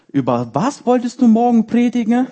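a hint of a playful heavenly rebuke; voice of the Holy Spirit. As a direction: gently tease as the Holy Spirit would. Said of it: "kam der Heilige Geist und hat zu mir gesagt".

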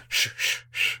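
sharp, drawn "shh" noises; hushing shushes. Retold or imitated. Buddha hushing the snake in the background, hushing. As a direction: imitate someone urgently shushing. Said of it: "Buddha's going".

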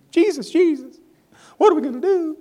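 high-pitched comic panic; hammed-up frantic plea in a high voice; mock panic. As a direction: squeal it like someone helplessly fretting. Mimics people panicking over problems.

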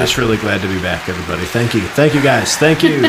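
rising hammy thanks to a crowd; awkward joy. Pretend. Mock celebrity thanking crowd.

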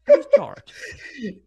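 like a dying Bluetooth speaker; robot voice. Pretend. Imita una bocina sin batería. (voz de bocina Bluetooth sin batería)

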